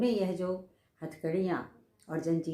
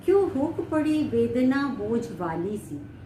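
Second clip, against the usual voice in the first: raised, louder recital of the verse; poem recitation; 5 semitones higher, 5 dB louder than usual. Acted. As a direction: declaim the line like a poet. Teacher recites poem line. (कविता पाठ का स्वर)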